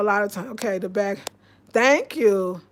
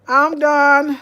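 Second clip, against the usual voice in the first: loud exasperated cry; 2 semitones higher, 12 dB louder than usual. Real, not acted. Speaker to her viewers, loudly exasperated. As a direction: blurt it out, fed up.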